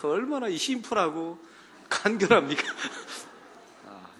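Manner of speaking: usual speaking voice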